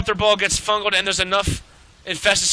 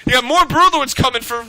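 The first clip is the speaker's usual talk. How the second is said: ordinary delivery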